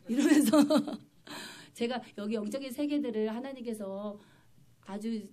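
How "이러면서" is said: laughing. Real, not acted. Preacher laughs after her own demonstration, laughing. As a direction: break up laughing while explaining. Breaks up after imitating the groan.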